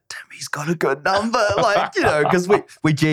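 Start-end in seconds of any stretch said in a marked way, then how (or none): 0.1-1.4 soft whisper of admiration; whispered aside. Retold or imitated. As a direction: whisper it so nobody on set hears. Aside to himself on set.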